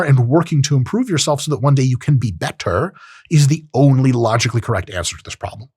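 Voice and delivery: ordinary talk, nothing marked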